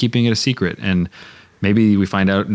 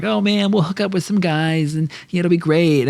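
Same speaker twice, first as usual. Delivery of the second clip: put-on much higher, gushing tourist girl voice; imitating excited girls hoping to hook up; giddy friend; 10 semitones higher than usual. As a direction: play the giddy friend on vacation. Friends excited about Iceland trip.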